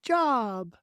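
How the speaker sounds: high, echoing bird voice; a baby goose copying a word; little gosling voice. Acted.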